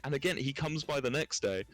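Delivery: ordinary delivery, nothing marked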